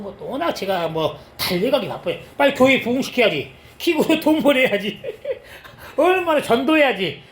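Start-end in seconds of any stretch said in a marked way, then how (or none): 2.4-5.0 mock eager self. Mocking his own rushing mindset. 5.1-5.9 high unvoiced burst of laughter; short silent-style giggle; breathy laugh. Real, not acted. Laughing at himself. 6.0-7.2 mock eager self. Mocking his own rushing mindset.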